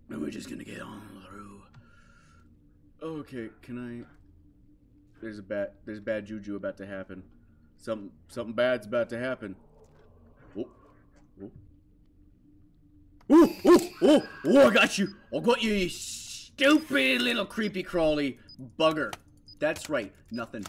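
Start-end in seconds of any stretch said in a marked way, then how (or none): 0.1-1.6 softer whispered words. Real, not acted. Player whispers while sneaking through the soup. 13.3-19.1 high, loud gloating yell; triumphant yell. Real, not acted. Player gloats after scaring off the creepy crawly. Catching the monster in photo.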